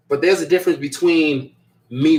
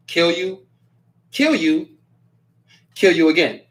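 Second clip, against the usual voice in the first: slightly raised, measured threat with pauses; deadpan threat readout; 2 semitones higher than usual. Retold or imitated. Host reading a viewer's three comments, measured mock threat. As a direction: read each threat as its own verdict. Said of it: "the way I read it".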